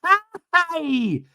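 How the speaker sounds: high, loud squealed greeting; mock a lovestruck woman on FaceTime; imitating gushing girlfriend. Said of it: "Alexis is like".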